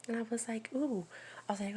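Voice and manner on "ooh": high, louder playful ooh; a sing-song curious ooh, higher pitched; mock surprised ooh. Teasing surprise at son's words.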